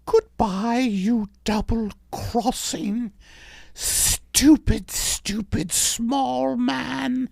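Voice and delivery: high, very slow insult; slow contempt. Acted. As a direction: drag out each insult.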